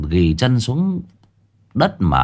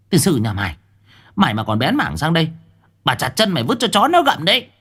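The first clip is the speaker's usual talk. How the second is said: angry cursing that climbs high and fast; panting curses; 9 semitones higher, faster than usual. A narrator's reading. Woman threatening the fool who ran off, panting angry curses. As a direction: curse at them, pitch rising with each threat. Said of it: "hổn hển chửi".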